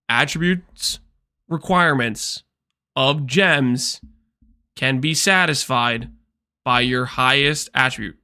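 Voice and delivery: word by word, with long pauses; slowly. Shown as a demonstration. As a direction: spell it out slowly for chat. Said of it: "slowly so you guys can hear me".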